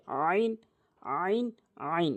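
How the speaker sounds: "আইন" squeezed from mid-throat; pressed "আইন". Shown as a demonstration. Teacher models an often mispronounced letter, pressed throaty "আইন". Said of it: "চাপ দিয়ে".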